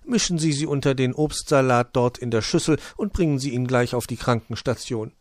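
a motherly hedgehog giving orders. Acted. Frau Igel giving instructions.

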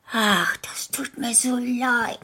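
put-on high childlike whisper into the ear. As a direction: whisper pityingly into the wolf's ear. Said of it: "flüsterte es in sein großes Ohr".

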